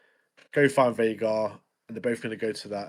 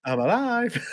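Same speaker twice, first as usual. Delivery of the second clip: high mock cry through laughter; laughing re-enactment of the scene; laughing imitation; 11 semitones higher, slower than usual. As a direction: giggle as you ham up the line. Re-enacting Aegon's line, laughing.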